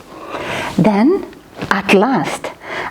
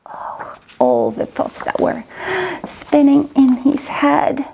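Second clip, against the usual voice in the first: ordinary delivery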